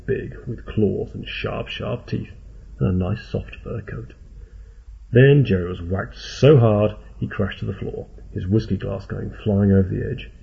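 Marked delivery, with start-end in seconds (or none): none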